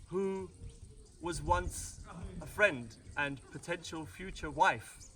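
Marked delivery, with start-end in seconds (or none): none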